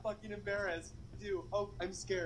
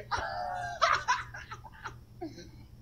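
high shrieking laugh, much higher; squealing laugh. Real, not acted. Told to jump in the fountain, nervous shrieking laugh.

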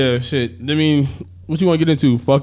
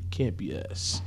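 sounding high; talking while high; stoner; 6 dB quieter than usual. Real, not acted. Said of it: "I'm kinda high".

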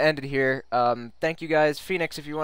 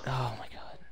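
breathless whispered oh my god; 2 semitones lower, 13 dB quieter than usual. Real, not acted. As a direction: whisper it in shock. Scared by game crash.

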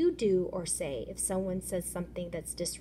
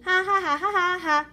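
high, loud fake jeering laugh; mocking laugh; 9 semitones higher, 7 dB louder than usual. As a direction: laugh at him to mock.

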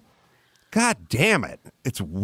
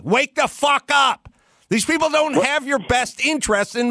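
angry shout; 7 semitones higher than usual. Real, not acted. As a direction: yell it at the whole room. Ranting at listeners.

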